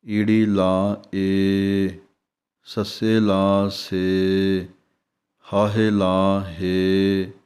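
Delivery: very slow, each syllable spelled out and the long vowel stretched; drawn-out spelling demo. Shown as a demonstration. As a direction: spell it out slowly, like a teacher to a class. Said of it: "ਜਿਵੇਂ".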